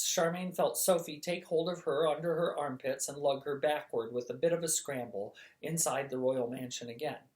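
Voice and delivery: ordinary delivery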